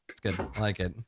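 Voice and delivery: usual speaking voice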